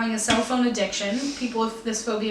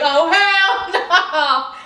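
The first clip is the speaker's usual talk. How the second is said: slow loud laughing quote; 6 semitones higher, 6 dB louder, much slower than usual. Retold or imitated. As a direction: stretch it out while laughing. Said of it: "I was like".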